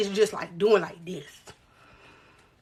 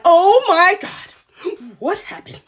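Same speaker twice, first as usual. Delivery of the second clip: emotional neighbor; 4 semitones higher, much slower than usual. Retold or imitated. Neighbor seeing the cut face, imitated emotional shock. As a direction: imitate a neighbor's horrified reaction. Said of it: "She's emotional".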